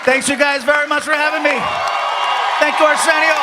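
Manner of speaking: real shout to the crowd; shouted thanks. Real, not acted. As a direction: call out over the applause. Calling thanks to the cheering audience.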